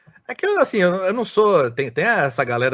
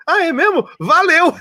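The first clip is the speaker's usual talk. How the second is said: playful surprise; 5 dB louder than usual. Retold or imitated. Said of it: "Eu falei".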